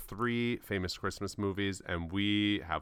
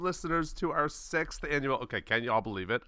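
normal talking voice

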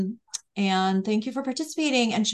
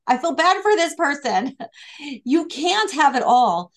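ordinary talk, nothing marked

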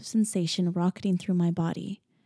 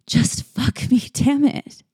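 desperate; 6 semitones higher, 4 dB louder, slower than usual. A narrator's reading. Desperate plea while tied.

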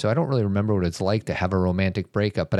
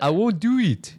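Arnold Schwarzenegger; 11 semitones higher than usual. Retold or imitated. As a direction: punch it like an action hero. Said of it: "Schwarzenegger accent".

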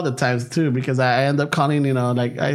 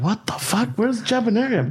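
higher, baffled what the fuck; puzzled over a strange street name; retold confusion, pitched up; confused; 8 semitones higher than usual. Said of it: "I'm like".

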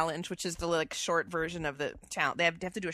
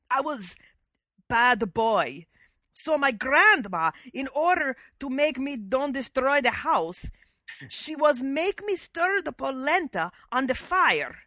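slow, loud, higher hammed-up Italian accent; Fabio's Italian accent; 5 semitones higher, 6 dB louder, slower than usual. Co-host reading Fabio's polenta story, bad Italian accent. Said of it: "Our Italian accents are so bad".

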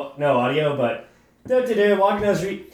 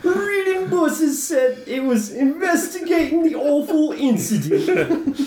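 higher, laughing mock British accent; hooligan accent; 7 semitones higher than usual. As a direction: crack up while keeping the cockney. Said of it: "rate his accent".

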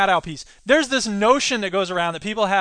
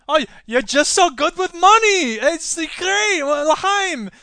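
mock Romney; 6 semitones higher, 4 dB louder, slower than usual.

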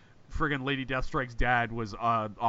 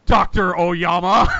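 slow, loud, higher-pitched dramatic reveal; mock-dramatic; 6 semitones higher, 10 dB louder, slower than usual. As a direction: land the name like a hammed-up plot twist. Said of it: "Pause for dramatic effect".

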